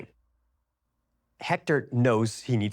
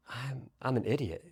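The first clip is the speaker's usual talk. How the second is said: ashamed whisper; 8 dB quieter than usual. Acted. Achilles hears friend is dead.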